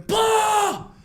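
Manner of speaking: high, loud panicked scream. Retold or imitated. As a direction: scream for Paul to come now. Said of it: "So I'm screaming".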